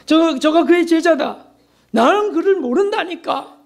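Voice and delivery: high, slow, flustered denial in another voice; panicked denial. Retold or imitated. Peter at the fire insisting he does not know Jesus, panicked. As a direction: deny it in a rising panic.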